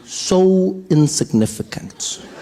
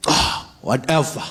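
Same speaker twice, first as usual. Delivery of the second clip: overdone dismissal; 3 semitones lower, 4 dB quieter than usual. Shown as a demonstration. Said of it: "No, you don't have it".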